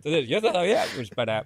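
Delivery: ordinary delivery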